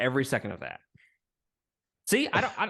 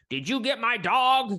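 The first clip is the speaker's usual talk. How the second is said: hammed-up cop calling out; mock Tennessee cop; 12 semitones higher, 4 dB louder than usual. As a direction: lampoon the cop calling from the gazebo. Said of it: "he's like".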